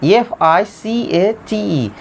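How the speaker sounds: slow, letter-by-letter dictation; measured dictation of each letter; spelling out letters. Shown as a demonstration. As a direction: spell it slowly for the class. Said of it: "certificate C E R".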